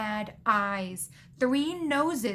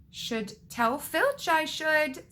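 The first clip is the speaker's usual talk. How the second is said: slow, higher mock-saintly voice; 6 semitones higher, slower than usual. A narrator's reading. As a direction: draw it out with false piety. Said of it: "in a saintly voice".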